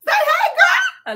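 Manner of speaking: squealed high with laughter; giddy high-pitched hello; excited squeal. Real, not acted. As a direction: shriek it to your bestie. Excited greeting to best friend.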